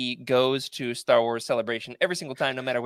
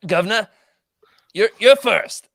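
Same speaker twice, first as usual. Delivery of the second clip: loud, higher mock-British call; attempted British accent; 8 semitones higher, 8 dB louder than usual. Host kicking off a game to co-hosts, attempted British accent. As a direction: have a go at a hammed-up British accent. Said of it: "Can we play it in British accents?".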